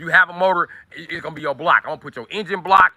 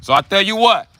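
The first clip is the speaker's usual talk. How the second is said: higher-pitched mock Mexican accent, selling the bikes; imitating a Mexican accent, pitched up; 6 semitones higher than usual. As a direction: play a Mexican bike seller in a hammed-up Mexican accent. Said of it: "Maybe I like the Cuban accent better than the Mexican accent".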